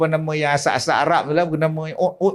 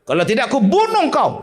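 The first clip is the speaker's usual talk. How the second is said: raised, threatening healer voice; fierce threat; 8 semitones higher, faster than usual. Retold or imitated. Rough healer threatening the jin, fierce. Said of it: "Ui, garangnya".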